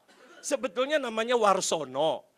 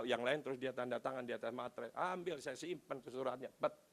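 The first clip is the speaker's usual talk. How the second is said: ordinary talk, nothing marked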